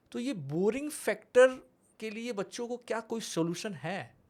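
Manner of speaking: ordinary talk, nothing marked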